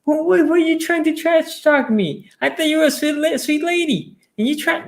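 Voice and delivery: very high, indignant squeal; mock outrage. Ravens fan confronting the lady, in a very high indignant voice. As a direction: play up being wronged. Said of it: "I'm like".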